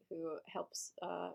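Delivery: ordinary talk, nothing marked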